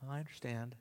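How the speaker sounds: soft, polite agreement; a quiet fake-agreeable murmur; just nodding along, softly. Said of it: "we smile and we nod".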